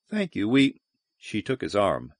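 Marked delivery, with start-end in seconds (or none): 0.1-0.5 higher thanks, soft; high thank you. Shown as a demonstration.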